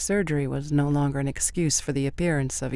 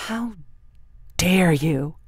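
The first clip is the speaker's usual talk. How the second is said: angry; 2 semitones higher, 3 dB louder, slower than usual. A narrator's reading. Son's anger breaks out.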